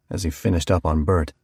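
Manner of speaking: ordinary delivery, nothing marked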